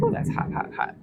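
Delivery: ouch, that's hot. Real, not acted. Touching the hot grill grates.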